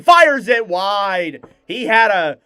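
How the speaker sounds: loud, slow shot call; excited call. Acted. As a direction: let the near-miss ring out.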